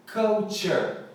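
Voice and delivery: ordinary talk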